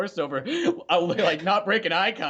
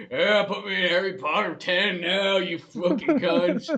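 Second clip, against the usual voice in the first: slow, hammed-up Southern drawl; Southern accent; 2 semitones higher, slower than usual. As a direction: stretch it out in a mock Southern accent. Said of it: "It's a Southern accent".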